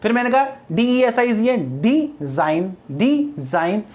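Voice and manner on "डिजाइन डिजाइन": "Design" split as डी, pause, Zain; "design" in two distinct beats; syllable by syllable. Shown as a demonstration. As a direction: separate डी from Zain in "design", each time. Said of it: "एक तो ये हो गया डी".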